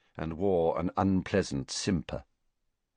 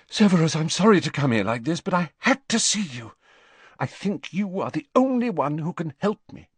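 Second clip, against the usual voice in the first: fast, higher, breathless plea; in a rush; 9 semitones higher, faster than usual. A narrator's reading. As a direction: blurt it all out at once. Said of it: "she said in a rush".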